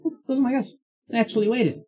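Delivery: high-pitched surprised reaction; high voice, pleasantly surprised; surprised character. Acted. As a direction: pipe up on spotting your own people. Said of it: "the pin immediately is like".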